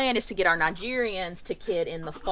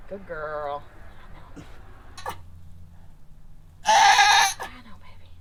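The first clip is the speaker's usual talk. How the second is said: talking to a goat; slower than usual. Real, not acted. Soothing the birthing goat.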